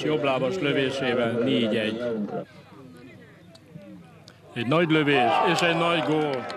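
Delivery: loud, high live goal calls; excited hungarian sports commentary. Real, not acted. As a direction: call the goals like a thrilled commentator. Live goal commentary, louder, higher.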